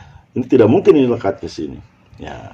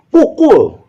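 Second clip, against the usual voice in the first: "Pukul" high and loud, both syllables lengthened; louder falling intonation; 8 dB louder than usual. Shown as a demonstration. Teacher showing another meaning, "Pukul" loud and firm. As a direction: push "Pukul" out longer and firmer. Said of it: "tapi intonasi yang berbeda".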